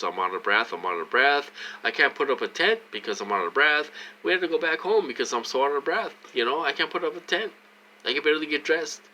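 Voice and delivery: imitating himself begging to be heard, rushing and rising; pleading complaint. Retold complaints to the nurse.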